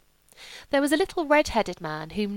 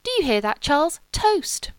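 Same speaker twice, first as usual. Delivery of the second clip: rising higher, thrilled call to her husband; gushing delight; 9 semitones higher, 5 dB louder than usual. Acted. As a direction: squeal it like a treat. Wife gushing over the toast.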